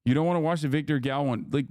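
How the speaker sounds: usual speaking voice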